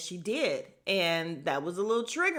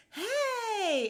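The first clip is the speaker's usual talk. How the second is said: high shrill-free yelled hey. Retold or imitated. Mocking Renna's loud entrance.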